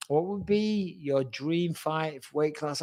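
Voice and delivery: drawn-out, thinking-aloud repeat of the question; slow, half-to-himself reread; slow musing reread. Real, not acted. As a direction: read it back slowly, mulling it over. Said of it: "W-, what would be your dream fight".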